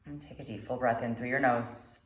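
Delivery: calm meditation guide, long pauses between phrases; guided meditation. Acted. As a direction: guide them gently through the breath, unhurried.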